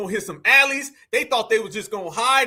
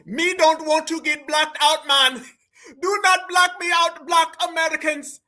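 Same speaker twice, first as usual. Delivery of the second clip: higher-pitched, hammed-up Jamaican patois; mock Jamaican accent; 5 semitones higher than usual. Translating the woman's clip for y'all, mock Jamaican accent. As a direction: imitate a Jamaican accent, drawing out the last word into singsong. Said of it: "Translation, y'all".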